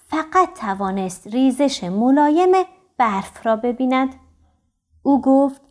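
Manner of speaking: ordinary delivery, nothing marked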